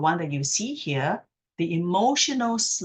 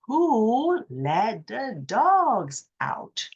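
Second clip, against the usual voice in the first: drawn out very slowly and higher, all on one breath; 5 semitones higher, much slower than usual. Shown as a demonstration. Speech coach demonstrating a slow line on one breath. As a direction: stretch the whole line out on a single breath. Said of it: "So it's slow".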